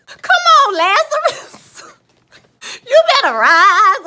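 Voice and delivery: high, loud church shout, cracking with feeling; over-the-top revival cry; mock preacher holler. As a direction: call him up out of the casket like a hyped preacher. Mock preacher calling the dead up.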